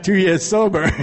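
amused laugh at the irony. Real, not acted. Amused at mother's request.

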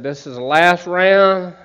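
calling last round. Retold or imitated.

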